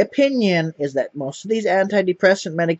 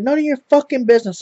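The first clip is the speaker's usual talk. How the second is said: quick, higher snapped retort; mock angry retort; 8 semitones higher, faster than usual. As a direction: fire it back fast like a touchy roommate. Angry roommate retorts in retold story.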